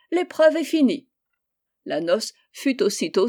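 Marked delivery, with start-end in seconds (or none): 0.1-1.1 high, loud, jubilant; princess's elated cry; exclaimed announcement. A narrator's reading. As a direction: proclaim it triumphantly. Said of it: "s'écria la princesse".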